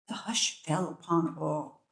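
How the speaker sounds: whispered narration; hushed whisper. A narrator's reading. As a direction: breathe it in a hush. Hush after the king's shout.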